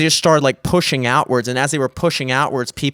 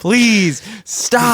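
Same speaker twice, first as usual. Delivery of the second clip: high, emphatic begging; imitated desperate pleading; mock exasperated plea; 7 semitones higher than usual. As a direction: implore with each word set apart. Retold plea to screaming man.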